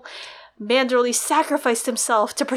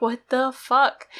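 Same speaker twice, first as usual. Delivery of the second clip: baffled; slower than usual. Retold or imitated. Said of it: "He's like".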